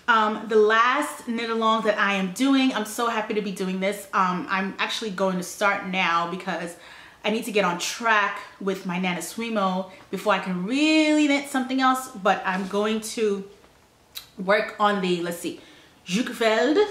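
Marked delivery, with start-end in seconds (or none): none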